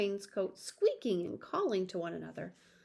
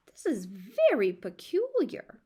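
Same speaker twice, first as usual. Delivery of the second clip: puzzled tailor; 2 semitones higher, 5 dB louder than usual. A narrator's reading.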